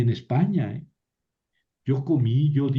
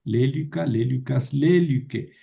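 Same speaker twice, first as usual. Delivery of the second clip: Lélica, lélicas, lélique with the stress on LÉ; LÉ-li-ca, LÉ-li-cas, LÉ-li-que; proparoxytone stress. Shown as a demonstration. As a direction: demonstrate Lélica, lélicas, lélique as words stressed third from last. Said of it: "Y sí puedo tener proparoxítonas".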